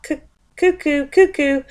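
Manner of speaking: high two-note cuckoo call; cuckoo clock. A narrator's reading. Living room clock keeping Mister Bear awake, high cuckooing.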